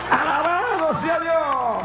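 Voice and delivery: high, fervent pulpit cry; high-pitched revival preaching; preaching at full force. Acted.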